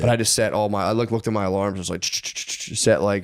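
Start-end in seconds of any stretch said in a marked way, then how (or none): 2.0-2.8 quick unvoiced ch-ch sounds; whispered clicking noise. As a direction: whisper a rapid clicking tick. Rapidly setting phone alarms.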